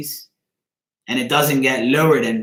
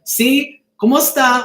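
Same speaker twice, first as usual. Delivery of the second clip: higher-pitched, eager Spanish greeting; imitated Spanish speakers; 11 semitones higher, 3 dB louder than usual. Latinos in Sears greeting him, imitated high voice. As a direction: voice a questioning greeting, pitched well up.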